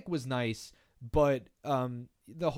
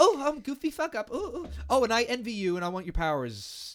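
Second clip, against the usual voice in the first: high goofy villain voice; 7 semitones higher than usual. Retold or imitated. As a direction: play a dopey wannabe villain. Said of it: "She was, like, goofy".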